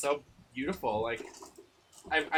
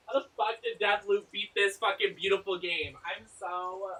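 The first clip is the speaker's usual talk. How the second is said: normal talking voice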